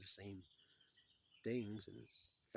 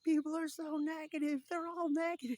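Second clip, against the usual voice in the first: high, loud retold outcry; frantic man, acted over the top; hammed-up panic; 8 dB louder than usual. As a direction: cry out in mock distress. Retelling man who hears thoughts.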